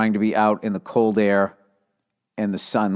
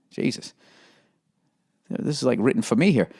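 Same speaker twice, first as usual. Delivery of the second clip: usual speaking voice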